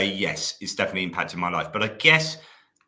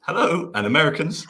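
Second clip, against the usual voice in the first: sing-song hello, much higher; playful greeting; 8 semitones higher, 4 dB louder than usual. Real, not acted. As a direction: chirp a cheery hello to the chat. Playful hellos to chat groups.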